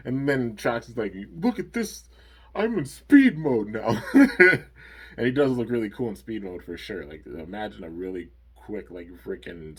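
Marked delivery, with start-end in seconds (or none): 1.4-4.0 high, hyped-up brag with a laugh; boastful showoff. Acted. Trox bragging about speed mode, high and excited. 4.1-5.1 high burst of laughter; delighted laugh. Real, not acted. Host cracking up at Trox's line, loud laugh. After the Trox quote.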